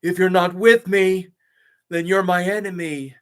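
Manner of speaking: hammed-up furious Jedi; Anakin impression. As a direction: throw it at a former master in anger. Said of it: "If you can please do an Anakin".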